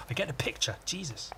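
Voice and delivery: hushed, exasperated aside; soft, half-whispered comeback; muttered retort. Acted. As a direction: grumble it under your breath. Hero's muttered reply to corpses.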